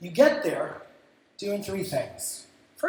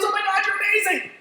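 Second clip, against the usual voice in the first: high, fast mock gushing; hammed-up starstruck client; 13 semitones higher, 5 dB louder, faster than usual.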